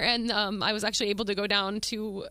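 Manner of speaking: ordinary delivery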